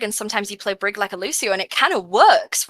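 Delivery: usual speaking voice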